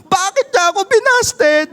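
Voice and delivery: hammed-up griping believer; mock complainer. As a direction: believer whining to God after rejection, high and fast. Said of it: "napapatanong ka".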